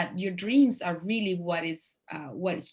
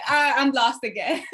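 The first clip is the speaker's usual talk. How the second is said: laughing; 8 semitones higher, 7 dB louder than usual. Retold or imitated. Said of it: "I was like".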